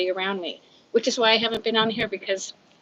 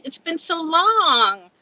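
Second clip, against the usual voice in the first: delighted greeting; 8 semitones higher, 6 dB louder, much slower than usual. Real, not acted. Greeting viewer Joni after a long time, high and drawn out. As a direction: stretch it out with joy.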